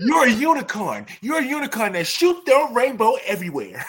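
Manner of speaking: high-pitched, giggly hype; over-the-top cheerleader praise; gushing affirmation. Pretend. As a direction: gush absurd praise in a high voice. Gushing mock affirmation, laughing.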